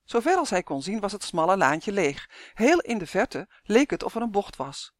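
usual speaking voice

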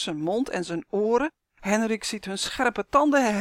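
usual speaking voice

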